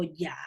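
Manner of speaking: YA said low, soft and without any rise; non-question intonation. Shown as a demonstration. Teacher contrasting with a question, YA as a low statement. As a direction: let YA sit low, as a statement. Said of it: "usted no la pondría en, en signos de, de interrogación".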